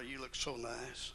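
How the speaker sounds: soft, slow loving compliment; tender compliment. Retold or imitated. Husband complimenting his dressed-up wife, soft and slow. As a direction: say it gently to your wife. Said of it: "always tell her".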